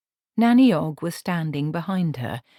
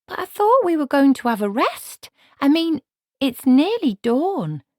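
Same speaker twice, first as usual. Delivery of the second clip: put-on higher, louder, earnest young woman; young Margaret protesting; 11 semitones higher, 6 dB louder than usual. A narrator's reading. Margaret protests to Granny, high and earnest. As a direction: plead like a puzzled girl.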